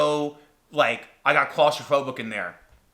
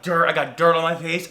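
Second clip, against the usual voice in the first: ordinary talk, nothing marked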